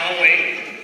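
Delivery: usual speaking voice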